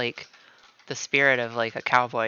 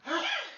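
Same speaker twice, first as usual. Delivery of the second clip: a sudden high gasp of delight; sharp gasp. Real, not acted. Streamer gasps at a memory, sharp gasp. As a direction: catch a quick excited breath.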